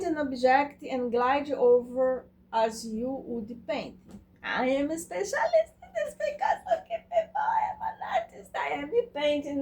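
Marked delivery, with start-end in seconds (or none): 4.4-9.6 high, giggly boast breaking into laughter; laughing. Real, not acted. Painter to viewers, giggling about being an artist. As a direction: laugh through a proud little boast.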